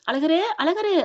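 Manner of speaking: acted calling from outside. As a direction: call a name twice to get attention. Calling through the door.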